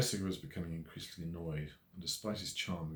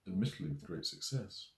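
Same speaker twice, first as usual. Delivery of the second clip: usual speaking voice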